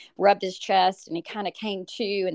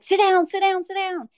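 frantic high shout, much louder, at the collapsing son; panicked yell; 12 semitones higher, 5 dB louder than usual. Retold or imitated. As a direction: order him down in pure panic. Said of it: "and I'm screaming".

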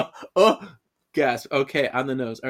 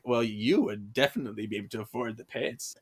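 low, softer mock French accent; hammy, lowered French accent; bad French accent; 5 semitones lower, 7 dB quieter than usual. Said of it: "the girl doing a bad French accent".

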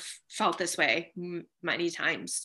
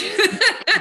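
short laugh; 13 semitones higher, 9 dB louder than usual. Real, not acted. Laughs at her own joke.

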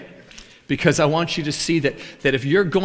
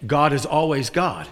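ordinary talk, nothing marked

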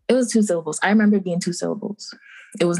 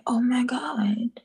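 whispered aside; 5 semitones higher, much slower than usual. Retold or imitated. Retold reaction, whispered.

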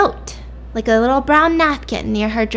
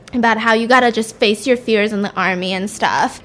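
ordinary talk

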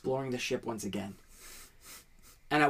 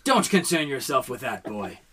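stern captain; 3 semitones higher, 6 dB louder than usual. Acted. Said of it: "No one liked seeing the captain mad".

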